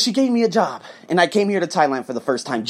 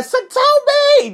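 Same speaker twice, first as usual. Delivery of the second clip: a high, drawn-out call of her name; 4 dB louder, much slower than usual. Retold or imitated. Calling her name at airport.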